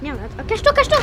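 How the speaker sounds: urgent. Real, not acted. Monster coming, urging to hide.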